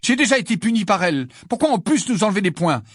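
high, loud, indignant complaint; loud aggrieved objection; furious protest. A narrator's reading. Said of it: "furieux de cette injustice".

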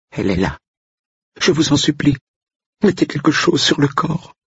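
high, pleading, terrified voice; frightened cook. Acted. Cook begging Andrew to cover the body, high terrified plea. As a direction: beg him in a high frightened voice.

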